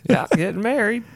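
squeaky high falsetto; high mock voice. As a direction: chirp it high.

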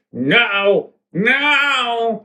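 high, drawn-out mock wail; dying villain band. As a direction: wail in hammed-up defeat. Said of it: "One Direction goes".

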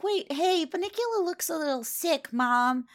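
put-on a kid worried about his pet; young boy voice. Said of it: "Toby is like".

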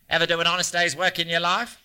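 fast mocking question through laughter; laughing taunt. A narrator's reading. Hanlon mocking writer, laughing.

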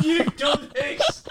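very high, drawn-out mock screech; hammed-up accusing squeal, stretched slow; mock blaming. Cook blaming kids for burnt garlic, high mock screech.

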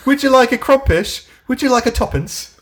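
high, loud posh British nanny, laughing through it; mock posh British accent. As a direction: coo like an old-fashioned English nanny offering treats. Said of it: "Why are you talking in an accent?".

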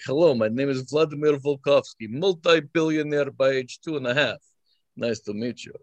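hammed-up fake Russian accent introducing yourself to strangers. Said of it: "I used to do voices".

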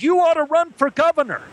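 high-pitched crowd scream; screamed cheer. Acted. The crowd cheering the actor on stage, screamed in delight. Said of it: "they screamed".

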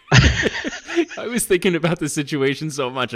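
high, slow, laughing. Real, not acted. Laughing to his friend about forgetting the plate. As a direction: laugh at your own blunder.

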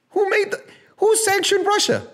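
laughing disbelief, voice jumping high. Real, not acted. Ranting at viewers about sanctions, laughing in disbelief. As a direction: laugh it out in incredulity. Laughing incredulous rhetorical question.

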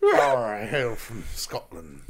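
slow, low Scottish brogue; Scottish accent. Acted. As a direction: announce it like a proud Scot. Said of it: "Scottish pirate".

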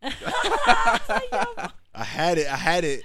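squeaky high laugh at his own slip; laughing squeal. Real, not acted. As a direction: laugh it out after messing up. Laughing at flubbing his plug.

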